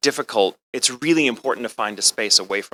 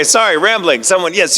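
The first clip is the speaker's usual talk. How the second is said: ordinary talk, nothing marked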